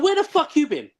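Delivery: high-pitched mock outrage at a late teammate; angry retold yell. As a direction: throw it up high like you're fuming at him. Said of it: "I was so angry about it".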